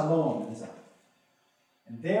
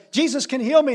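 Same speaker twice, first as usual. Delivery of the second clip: calling out; 8 semitones higher, 7 dB louder than usual. Acted. Blind man calling out.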